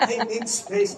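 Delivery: giggling through the quoted line; laughing repeat. Retold or imitated. Mother quoting her son again, laughing. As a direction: repeat it while cracking up. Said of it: "Palagi niyang sinasabi".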